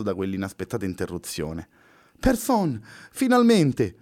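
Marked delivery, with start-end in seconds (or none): 2.2-4.0 much higher, joyful relieved cry; light up with relief at his arrival; touch of joy. Acted. Luna greets her rescuer with a higher joyful cry. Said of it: "con un briciolo di gioia nella voce".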